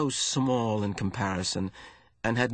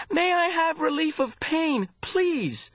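very high, desperate plea; pained begging; slower than usual. A narrator's reading. Hurting boy asks the giver for relief, begging. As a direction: plead through pain in a high strained voice. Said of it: "he begged".

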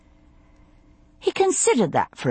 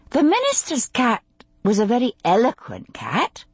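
higher, careful woman's voice; tactful, higher-pitched game turn; delicate; 6 semitones higher than usual. A narrator's reading. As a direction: venture it delicately, testing the ground. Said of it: "she said delicately".

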